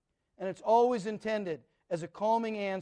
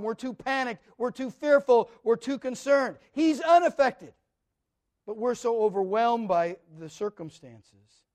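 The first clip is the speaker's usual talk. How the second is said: building preaching; 3 semitones higher, 8 dB louder than usual. Acted. Pastor listing the fears of the faithful, building preaching. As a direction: push each "we're too" harder.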